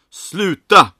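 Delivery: very loud, high command; furious shout. A narrator's reading. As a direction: shout to silence everyone. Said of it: "Johnnys röst tystade".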